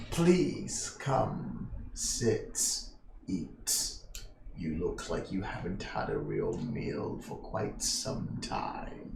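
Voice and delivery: put-on very low, hushed Aquilonian old man; Aquilonian accent. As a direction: coax guests softly in an Aquilonian accent, with long pauses. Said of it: "this is an Aquilonian accent".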